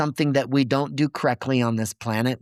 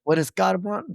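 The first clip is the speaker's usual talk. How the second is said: getting emotional; 7 semitones higher than usual. Real, not acted. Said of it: "I don't know why I'm getting emotional".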